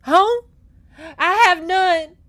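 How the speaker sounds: high, loud outburst of grief; bitter anguish. A narrator's reading. Homeless girl answers the rider, grief-stricken and bitter. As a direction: fling it back, anguished and bitter. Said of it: "with such grief and anguish and bitterness".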